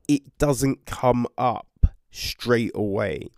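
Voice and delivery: normal talking voice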